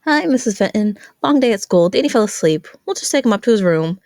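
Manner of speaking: breezy fake cheer to hide the truth; teen friend bluffing to Danny's mom; nervous friend.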